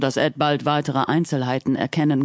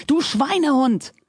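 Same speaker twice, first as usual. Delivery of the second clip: higher furious shout; 8 semitones higher than usual. A narrator's reading. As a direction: yell it in fury. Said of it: "schrie jetzt Wilmington".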